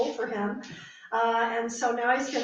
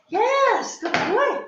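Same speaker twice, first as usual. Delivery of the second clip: much higher, louder cheering for the dog; excited praise; 12 semitones higher, 6 dB louder, much slower than usual. Real, not acted. Trainer cheering her dog after the puzzle, high and excited. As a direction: burst out with delight at the dog.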